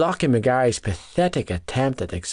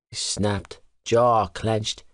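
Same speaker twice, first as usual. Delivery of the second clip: plain reading voice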